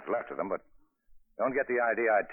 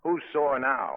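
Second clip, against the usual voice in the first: higher, louder, needling jab; teasing retort; 6 semitones higher, 5 dB louder, much slower than usual. Acted. Man teasing the woman who refuses the bottle, needling. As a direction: turn the tables and tease her.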